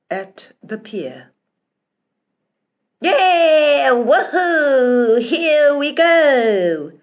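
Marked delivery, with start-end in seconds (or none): 3.0-6.9 loud, high, far-carrying cheer; joyful shout. Acted. Prince shouting goodbye to his people, loud joyful cheer. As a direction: whoop it out from the ship's deck. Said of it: "The prince shouted goodbye".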